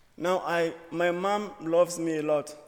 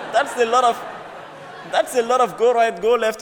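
louder and higher, laughing through the words; laughing disbelief; 6 semitones higher, 9 dB louder than usual. Real, not acted. To the audience, mocking the airport lady's directions, loud laughing. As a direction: laugh at how absurd the directions were.

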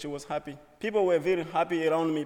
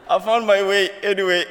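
louder, laughing while speaking; laughing relief; 3 semitones higher, 10 dB louder than usual. Real, not acted. To the audience, relieved after getting lost, loud laughter. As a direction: chuckle it out, relieved.

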